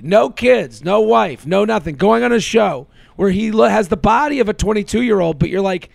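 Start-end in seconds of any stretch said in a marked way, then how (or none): none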